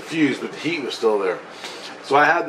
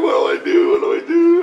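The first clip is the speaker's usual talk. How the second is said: loud, high crying shout; acted panic; 6 dB louder than usual. Teller reliving hearing Tia from far off, loud panicked cry. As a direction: cry out in frantic mock despair.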